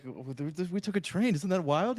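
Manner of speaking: high mock British tourist voice; bad British accent. British tourist telling of a train ride, bad British accent. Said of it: "like, a little British couple coming in".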